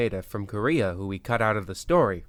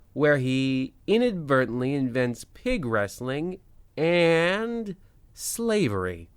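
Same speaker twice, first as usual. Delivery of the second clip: slow, stretched next-week teaser; dramatic announcer; 3 semitones higher, slower than usual. Acted. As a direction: draw out the teaser like a radio host. Host teases next episode.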